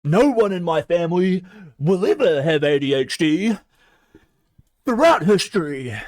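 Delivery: denial-filled homophobic parent. Retold or imitated. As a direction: shout it like an outraged bigoted parent. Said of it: "Where you're like".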